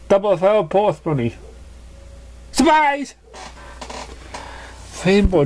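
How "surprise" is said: high, bright jump-scare cry; spring out and yell it; gleeful shout. Acted. Pegasus springing a prank on Rainbow Dash, gleeful shout. New pegasus jumps out yelling.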